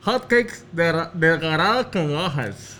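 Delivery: slow, halting, lips held apart; mushy, lipless articulation; garbled mouth-game speech. Real, not acted. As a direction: struggle through it without closing your lips. Mouth game, partner guesses distorted phrase.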